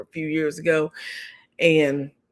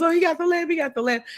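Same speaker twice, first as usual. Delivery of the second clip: much higher-pitched, mock-worried exclamation; imitated alarm; 12 semitones higher, faster than usual. Wife to husband about their son with the lamp, alarmed. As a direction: imitate someone fussing, pitch shooting up then easing.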